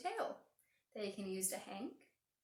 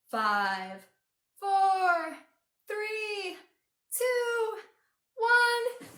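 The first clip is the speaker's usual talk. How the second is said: loud countdown climbing high after five; big drawn-out launch count, rising; rocket countdown; 10 semitones higher, 10 dB louder, much slower than usual. Pretend. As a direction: count down to blast-off, building to a high shout. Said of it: "count down like a rocket ship's gonna take off".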